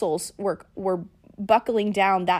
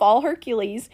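normal talking voice

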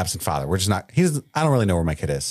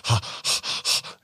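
breathy h starts; 2 semitones higher, 4 dB quieter than usual. Retold or imitated. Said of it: "sounds like Shauna huffing".

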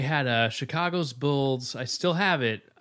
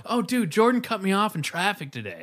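excited bragging; 9 semitones higher, 4 dB louder than usual. Pretend. Imagined driver bragging about Jordan.

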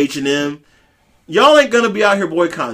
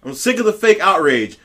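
normal talking voice

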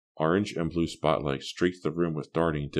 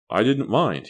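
attempted suave; 7 semitones higher, 6 dB louder than usual. A narrator's reading. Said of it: "trying to sound suave".

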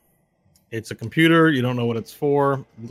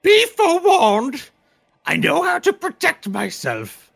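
high, louder, slow threat; menacing creature voice; 8 dB louder, much slower than usual. Acted. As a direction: warn them in a looming voice.

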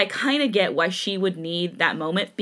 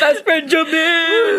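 drawn-out, loud fan cheer; excited cheer; 8 semitones higher, 8 dB louder, slower than usual. Real, not acted. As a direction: cheer his name out slowly and loudly. Fans cheering about Jimin.

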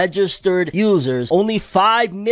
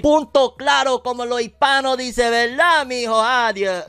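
put-on Spanish accent; 8 semitones higher, 3 dB louder than usual. Switches to mock Spanish.

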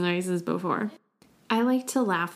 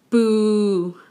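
a louder booing boo; disapproving boo; 5 dB louder than usual. Shown as a demonstration. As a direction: boo like a crowd that hates it. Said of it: "that's bad".

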